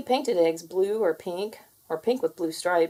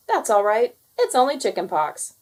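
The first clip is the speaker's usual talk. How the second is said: cook voice; 3 semitones higher, 6 dB louder than usual. Acted. Joke punchline, cook replies.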